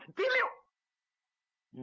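high, quick twirl of sound; imitated spinning noise. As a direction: voice a horse whirling round.

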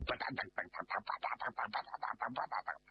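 rapid-fire patter of paddling noises; imitated splashing. Storyteller miming a frantic swim, rapid patter.

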